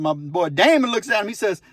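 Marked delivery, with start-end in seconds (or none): none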